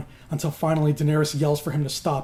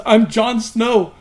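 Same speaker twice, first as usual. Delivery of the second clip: high, slow, louder distressed wail; acted desperate cry, pitched up; mock blubbering; 6 semitones higher, 6 dB louder, slower than usual. Broken Jon answers the queen who slapped him, mock blubbering. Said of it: "he's still blubbering".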